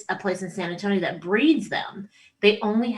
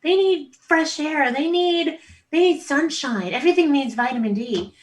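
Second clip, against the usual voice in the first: outdoorsy husband; 7 semitones higher than usual. Retold or imitated. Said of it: "the Aussie, who's Mister Outdoorsy, is like".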